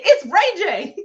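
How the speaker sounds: loud, laughing through it; laughing quote. Retold or imitated. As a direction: blurt his name while cracking up. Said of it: "He responded".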